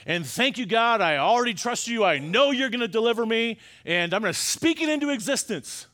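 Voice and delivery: mock triumphant prayer, high and loud; mock churchy confidence. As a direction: send it up like a sure-of-it believer. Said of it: "this is not what you heard in church".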